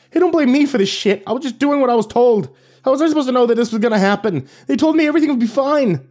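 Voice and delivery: high-pitched defensive yelling; yelling back. A narrator's reading. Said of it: "The cameraman yelled back".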